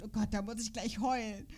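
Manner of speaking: usual speaking voice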